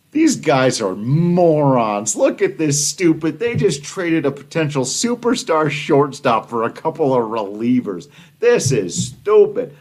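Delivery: mocking New York accent, slow and scornful. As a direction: sneer it like a New York fan, building. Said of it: "Why you gotta do it with a New York accent?".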